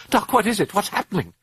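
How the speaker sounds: high, distraught question; anxious plea. A narrator's reading. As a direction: beg the doctor for answers, high-pitched. Distressed mother pleads with doctor.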